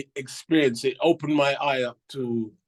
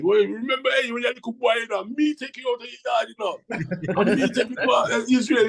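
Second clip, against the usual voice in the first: a hint of a teasing bandmate, higher; higher, mock-proud bandmate; teasing Brinsley impression; 6 semitones higher than usual. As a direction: lightly mimic the old friend ribbing you. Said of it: "Brinsley always rubs that in my face".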